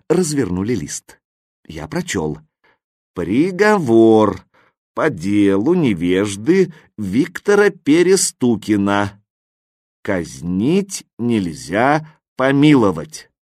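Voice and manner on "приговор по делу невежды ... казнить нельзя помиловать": slow, solemn court proclamation; ceremonial, measured, drawn-out reading; proclaiming a verdict. A narrator's reading. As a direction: read out the sentence with weighty pauses.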